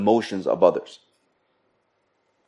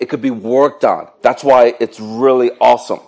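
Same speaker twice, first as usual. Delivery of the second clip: normal talking voice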